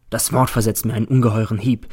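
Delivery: ordinary delivery, nothing marked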